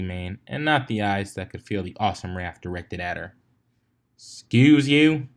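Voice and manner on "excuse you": slow, loud, indignant growl. A narrator's reading. Applejack snapping at the pony who knocked her hat, slow growl. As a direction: drag it out, menacing and pointed. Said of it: "the mayor practically growled".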